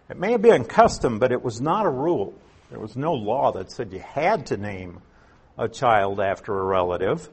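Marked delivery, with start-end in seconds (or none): none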